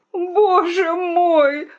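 slow, high drawn-out moan. Acted. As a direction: stretch it out in despair. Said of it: "простонал петух".

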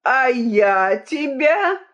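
slow, spluttered retort; choking. A narrator's reading. King slapping his rival back, choking retort. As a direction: choke out the counter-threat, drawn out. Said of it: "поперхнулся Толстопуз".

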